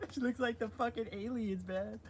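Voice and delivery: high, giggling words; laughing while talking. Real, not acted. Vlogger mocking the preacher's face, laughing through it.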